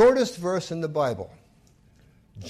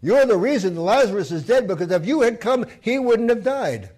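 loud, high blaming; it's all your fault, hurled; accusing; 5 semitones higher, 7 dB louder than usual. Acted. Sisters accuse Jesus of letting Lazarus die, loud blame. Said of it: "they were saying".

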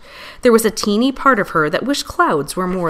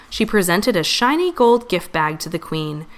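usual speaking voice

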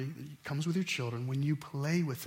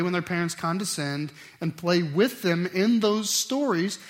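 ordinary talk

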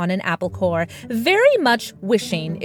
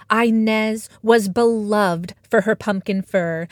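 plain reading voice

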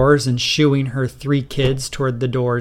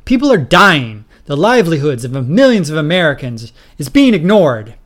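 loud, heated protest; angry plea; 3 semitones higher, 7 dB louder than usual. Acted. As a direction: push the point hard and loud. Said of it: "he demanded, angrily".

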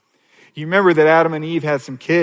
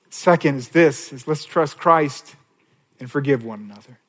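normal talking voice

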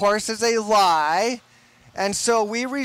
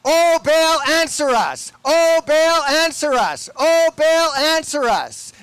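higher and louder pleading cry, Oh Baal pushed hard; 6 semitones higher, 6 dB louder than usual. Retold or imitated. Preacher acts prophets crying louder to Baal, loud pleading cry. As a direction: call out to a silent god, straining to be heard. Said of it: "They cried louder".

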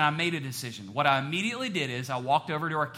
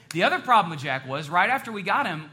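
normal talking voice

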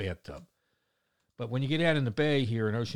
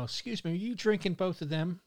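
prying lady; 8 semitones higher than usual. Retold or imitated. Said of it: "a lady comes up to me and says".

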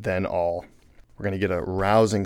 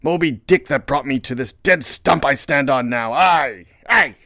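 anguished, raised cry; Ahab; 5 semitones higher, 5 dB louder than usual. A narrator's reading. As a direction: build to a loud, high wail. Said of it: "He shouted with a terrific, loud animal sob".

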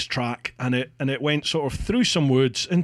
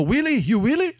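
high, eager broken English in an imitated Russian accent; mock Russian accent, keen and questioning; Russian worker voice; 10 semitones higher than usual. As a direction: egg him on to wheelie, in a Russian accent. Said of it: "he was just like".

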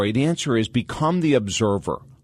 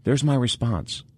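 calm self-observing voice; 3 semitones lower, 5 dB quieter than usual. Shown as a demonstration.

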